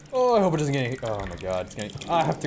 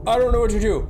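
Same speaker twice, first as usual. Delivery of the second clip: frantic oh-no's as danger hits; panicked; 7 semitones higher, slower than usual. Real, not acted. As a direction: fall apart in a fright. Panicked at in-game threat.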